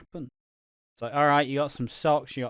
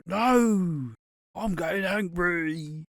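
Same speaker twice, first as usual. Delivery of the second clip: put-on raised, slow whiny kid protest; angry chubby kid; 6 semitones higher, 5 dB louder, much slower than usual. Kid losing Monopoly whining at his mum, mock angry. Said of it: "kid gets angry 'cause he's losing Monopoly".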